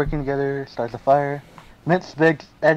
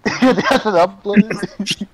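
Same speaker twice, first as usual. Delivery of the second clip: laughing repeat; 6 semitones higher, 5 dB louder, much slower than usual. Real, not acted. Repeating friend's joke, laughing.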